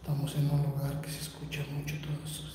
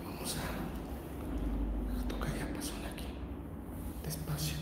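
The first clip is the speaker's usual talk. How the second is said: tense whisper in a haunted house; barely voiced, careful not to alert anything; hushed whisper; 7 dB quieter than usual. Real, not acted. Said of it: "no les voy a hablar más fuerte".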